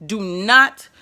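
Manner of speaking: ordinary talk, nothing marked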